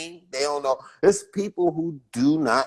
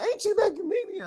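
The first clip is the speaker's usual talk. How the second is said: much higher, softer imitated fan asking; mock fan question; 6 dB quieter than usual. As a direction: squeak it like a starstruck stranger. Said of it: "some people will come up and be like".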